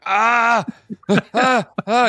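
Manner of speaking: high yelps of "ah"; mock pained cries. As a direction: cry out as if hurting mid-dance. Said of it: "people are going".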